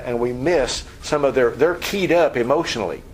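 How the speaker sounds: normal talking voice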